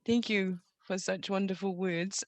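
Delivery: usual speaking voice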